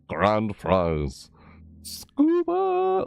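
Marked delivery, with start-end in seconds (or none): 1.8-3.0 very high squeal; squeaky exclaim. Real, not acted. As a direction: squeak the word out. Squeaky exclaim at item name.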